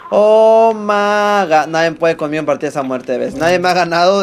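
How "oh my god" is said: loud, very slow "oh my god"; drawn-out exclamation. Real, not acted. Streamer reacting to the match, drawn-out exclamation. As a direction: stretch it out high and loud.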